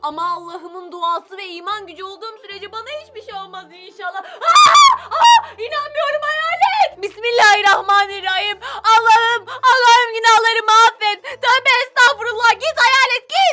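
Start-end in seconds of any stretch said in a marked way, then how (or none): 4.2-5.5 very high, loud shrieks of fright; girl screams on seeing the ghost; terrified scream. Acted. 5.6-7.0 frightened disbelief. Acted. Shocked at the ghost. 7.0-13.5 put-on girl prays in panic to drive the ghost away; panicked praying. Panicked prayer against ghost.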